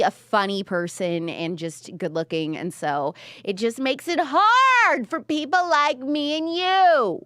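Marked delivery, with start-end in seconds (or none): 3.4-7.2 high, drawn-out playful wail; exaggerated plea, stretched and high; mock lament. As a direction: complain in hammed-up high-pitched despair. Mock lament over liking him.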